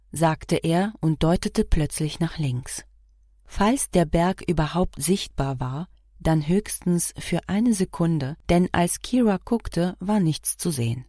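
plain reading voice

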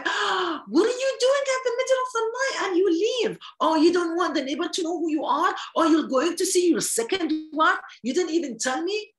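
high, accusing wife's voice; suspicious wife. Retold or imitated. Wife confronting her husband who sneaks home at night, high and accusing. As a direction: pitch up and accuse like a jealous wife. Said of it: "and the wife tell me".

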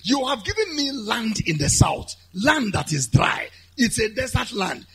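loud, high, urgent petition to God; impassioned preaching plea. Acted. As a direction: cry out the request at full preaching force. Preaches the plea at full force.

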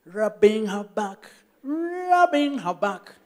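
slow rising sing-song; drawn-out repetition. Retold or imitated. Husband drags out the repetitive back rubbing, slow and rising. Monotonous rubbing during labor.

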